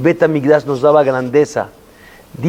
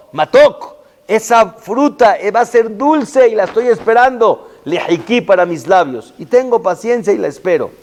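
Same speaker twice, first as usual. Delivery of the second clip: fervent preaching; 9 semitones higher than usual. Acted.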